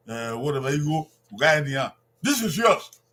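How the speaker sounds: ordinary delivery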